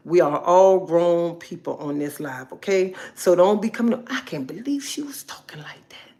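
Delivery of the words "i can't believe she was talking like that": hushed, whispered gossip; scandalized whisper. Acted. Gossiping viewer reacting to her live, scandalized whisper. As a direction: whisper it like shocked gossip behind her back.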